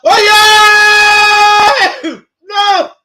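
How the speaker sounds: high, loud yell of hope then dismay; excited shout. Real, not acted. Fan watching Saka's run on the right, real excited shout then dismay. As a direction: leap up shouting, then groan no. Saka attack, goal chance missed.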